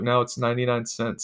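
ordinary delivery, nothing marked

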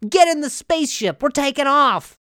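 a kid playing spaceship; excited kid. Kid in laundry basket calling to mom, high and eager. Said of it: "He's like".

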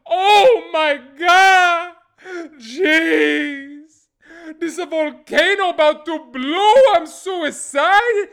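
high, loud, drawn-out Italian wailing; hammed-up Italian villager crying out; mock Italian accent. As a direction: panic theatrically in an Italian accent. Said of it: "I made 'em sound Italian".

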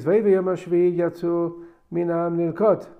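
normal talking voice

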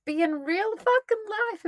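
impassioned, near-tearful cry of conviction; passionate exclamation. Real, not acted. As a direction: belt out the plea with real passion. Passionate peak of her plea.

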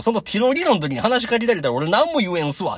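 loud, high-pitched mock complaint; exasperated protest. Podcaster complaining to the wrestler about interruptions, exasperated.

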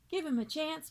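put-on a boy pleading with his teacher. A narrator's reading. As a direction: beg the teacher to give him a chance. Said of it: "pleaded Sam".

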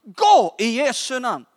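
high, forceful deliverance prayer; roared command. Retold or imitated. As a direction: roar it as a command to a demon. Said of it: "Men jag röt".

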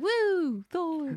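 high whooping fan cheer; excited cheer. Retold or imitated. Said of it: "I'll be like".

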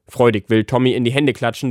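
ordinary delivery, nothing marked

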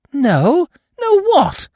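desperate to know the secret; baffled. Acted. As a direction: Eve pressing the uncle urgently. Eve baffled, demanding to know.

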